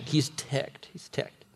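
second take breathed out softly; ticked, then whispered. Real, not acted. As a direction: repeat it fading to a whisper. Preacher repeats, second almost whispered.

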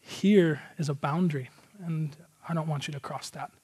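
softly spoken, measured and serious; quiet, controlled fatherly firmness; sober dad. Retold or imitated. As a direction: imitate a dad soberly laying down a rule. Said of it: "tell him once real soberly".

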